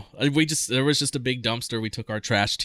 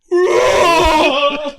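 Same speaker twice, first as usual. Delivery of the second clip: a high, loud yelp of shock; weird startled yell; 10 dB louder than usual. Retold or imitated. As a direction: yelp like a knuckle just dug into your chest. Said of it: "the weirdest sounding yell".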